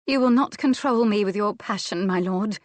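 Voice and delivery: plain reading voice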